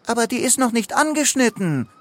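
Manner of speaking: high, fast interjection; objecting. A narrator's reading. Paddy stops Leo cutting the cake, quick high objection. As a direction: jump in with a quick high protest. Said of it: "warf Paddy ein".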